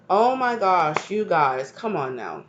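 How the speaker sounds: ordinary delivery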